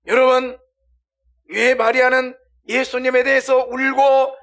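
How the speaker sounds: high, loud, crying-out sermon voice; full-force pulpit outcry; impassioned preaching. Real, not acted. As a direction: preach at the top of your voice.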